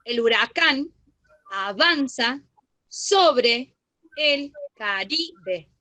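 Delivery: slow, word by word, "El huracán... avanza... sobre... el... Caribe"; careful pronunciation. Shown as a demonstration. Teacher showing the class how the example is pronounced, slow and deliberate. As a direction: draw out "sobre el Caribe" slowly, pausing between the words. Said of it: "¿Cómo se lo pronuncia?".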